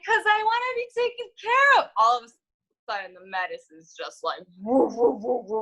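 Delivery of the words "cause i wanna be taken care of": high, pleading little voice; childlike whine. Retold or imitated. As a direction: beg like a small child. Whiny reply to psychiatrist.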